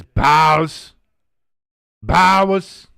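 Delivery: put-on loud New Jersey accent on Bows. Repeating a chat message in a loud New Jersey accent. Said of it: "I got that Jersey accent going".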